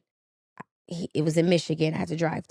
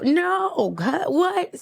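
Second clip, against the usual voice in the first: stunned disbelief; 9 semitones higher, much slower than usual. Retold or imitated. Hearing husband hid the letters.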